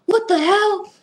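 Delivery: retold yell. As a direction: bark it like an angry mentor. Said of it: "He starts yelling".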